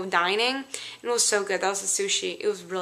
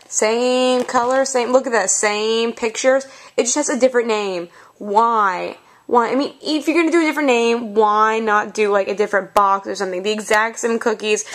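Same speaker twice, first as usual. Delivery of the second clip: loud, heavily punched complaint; exasperated rant; 6 dB louder than usual. Real, not acted. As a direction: protest loudly at the pointless change. Ranting about renamed identical cookie box.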